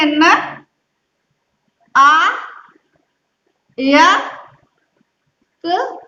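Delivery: न, आ, या, क each said alone with long pauses between; syllable by syllable. Shown as a demonstration. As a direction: split नायक into its sounds, one by one. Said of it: "किस तरह से उच्चारण करेंगे".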